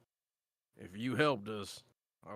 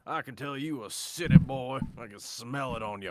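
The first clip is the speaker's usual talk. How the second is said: frontier dwarf; 11 dB louder than usual. Acted. Bulwark sneering at city boy.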